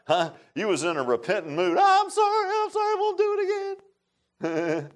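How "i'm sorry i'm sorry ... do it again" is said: put-on high, sing-song whining child begging daddy; mock child pleading. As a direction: wail it like a whipped kid, much higher. Child being whipped by daddy.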